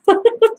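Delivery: a quick high laugh at her own joke. Real, not acted. Vlogger laughing at her own joke, high giggle. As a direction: burst into a short high giggle.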